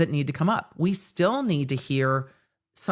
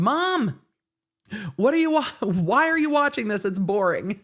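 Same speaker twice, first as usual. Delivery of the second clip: put-on whiny kid voice; 5 semitones higher than usual. Son's whiny complaint quoted.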